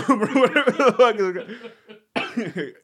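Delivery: loud, high and breaking into laughter; laughing hard. Real, not acted. Joking to co-host with a Harry Potter spell, laughing hard. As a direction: laugh your way through the words.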